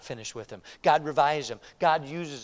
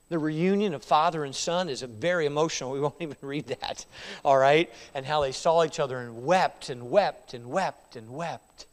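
ordinary delivery

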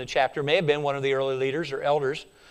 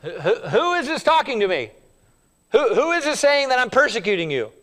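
higher, fast questioning; a hint of startled Saul; 5 semitones higher than usual. Saul on the ground, alarmed and higher. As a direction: ask in alarm. Voicing Saul again, agitated.

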